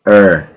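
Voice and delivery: "er" as one R-coloured vowel; shared ER sound. Shown as a demonstration. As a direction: demonstrate "er" as a single sound, falling at the end. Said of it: "hacen el sonido".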